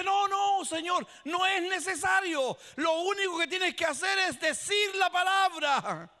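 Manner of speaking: loud, high urgent protest; urgent centurion. Acted. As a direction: stop him, insist he only speak. Centurion urgently stops Jesus.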